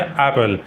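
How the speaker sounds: एप्पल with one light p, "ep-pal" not doubled; correct apple. Shown as a demonstration. Teacher modelling एप्पल for students to repeat. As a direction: say एप्पल with a single p sound. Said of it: "क्या उच्चारण है".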